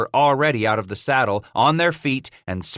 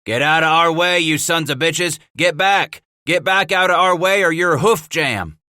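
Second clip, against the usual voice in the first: loud, high warning scream; screaming threat; 6 semitones higher, 5 dB louder than usual. Acted. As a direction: bellow them out of the way.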